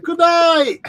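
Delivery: high, sing-song joke goodbye; playful sign-off. Pretend. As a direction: wrap it up like a mock outro. Jokingly ends the stream.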